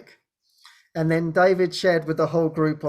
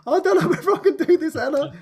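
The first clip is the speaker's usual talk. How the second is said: high, fast, laughing mock fright; scared little rabbit; 10 semitones higher, 5 dB louder, faster than usual. Telling Anna she can't do this, mock scared. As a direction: gabble it as a comically scared rabbit. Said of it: "like a scared little rabbit".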